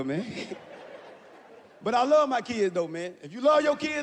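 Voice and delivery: usual speaking voice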